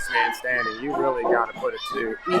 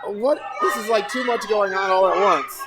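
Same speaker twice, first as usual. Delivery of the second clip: excited; 8 semitones higher than usual. Real, not acted. Excited co-commentary.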